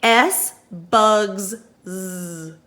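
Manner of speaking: slow, stretched sounds, "S bugs Z" with a long buzzing Z; slow sound demo. Shown as a demonstration. Teacher models the buzzing Z for the class, very slow. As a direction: drag out each sound slowly.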